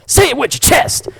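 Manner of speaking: high, loud comic shout; Kevin Hart impression. Quoting high school favourite bits, loud shout. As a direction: belt it out as a catchphrase. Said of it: "say anything by Kevin Hart".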